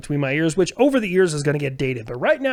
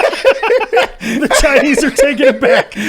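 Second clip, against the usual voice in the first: laughing; 9 semitones higher, 7 dB louder, much slower than usual. Real, not acted. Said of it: "laughing at joke".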